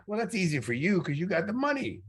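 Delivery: high whiny retort; whiny objection. Retold or imitated. Young [name] objecting to Sly about money, high whiny voice. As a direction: complain like a skeptical younger self.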